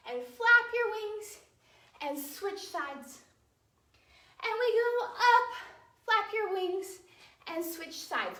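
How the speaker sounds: high, lilting dance cue, slowed; sing-song ballet teacher calling steps. Shown as a demonstration. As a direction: call the moves like counting music. Calling dance counts to class.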